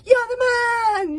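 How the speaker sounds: high, loud, slow cheering yell; happy crowd yell. A narrator's reading. Happy crowd yelling praise back at the chief. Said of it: "yelled the happy crowds".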